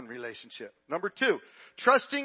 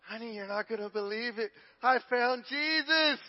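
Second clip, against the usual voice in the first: acted excited announcement; 6 semitones higher than usual. Husband phoning his wife with news of his conversion, excited. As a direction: stretch the name out, high and amazed.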